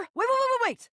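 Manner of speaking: high, rapid-fire pleading to stop; frantic interruption. Acted. Stopping the box going into the bin, frantic.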